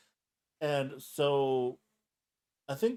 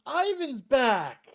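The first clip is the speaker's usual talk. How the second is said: high, loud, drawn-out announcement cry; triumphant mock shout; 11 semitones higher, 7 dB louder, much slower than usual. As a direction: holler it out slowly like a hero's return. Re-enacted cry when soldier survives.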